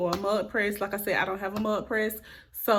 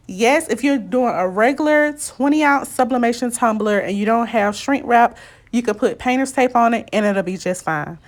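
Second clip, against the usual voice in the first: loud; 3 semitones higher, 11 dB louder than usual. Real, not acted. Sped-up loud explanation.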